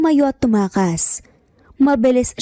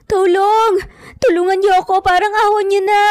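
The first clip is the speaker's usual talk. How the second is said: high, crying cries for help; crying scream for help; 9 semitones higher than usual. A narrator's reading. Andrea screams for help under Dante, crying. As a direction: scream for help through tears. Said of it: "Umiiyak nga na sigaw ni Andrea".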